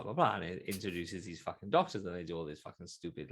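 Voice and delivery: usual speaking voice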